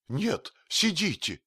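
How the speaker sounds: high, drawn-out shout of protest; slow, high-pitched frantic exclamation; alarmed cry. A narrator's reading. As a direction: cry out to stop them. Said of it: "закричал Изнурёнков".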